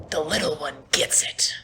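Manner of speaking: menacing whisper; sudden hushed threat after shouting; Captain Merrick. Acted. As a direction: whisper it as a chilling ultimatum. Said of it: "and he's just like".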